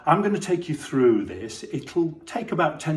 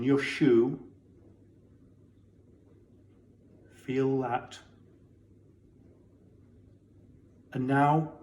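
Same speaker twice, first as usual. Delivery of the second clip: guided body scan; 6 dB quieter than usual. Acted. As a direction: guide them gently through the body, leaving space.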